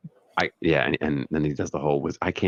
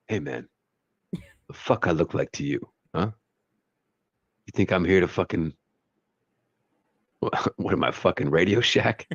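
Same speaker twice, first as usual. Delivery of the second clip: Mister Terrific voice. Retold or imitated. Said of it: "That's my Mister Terrific voice".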